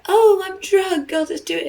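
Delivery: high, slow mock drunk voice; mocking drunk excuse. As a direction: mimic a whiny drunk excuse. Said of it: "it's like".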